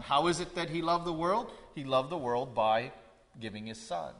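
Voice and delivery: ordinary talk, nothing marked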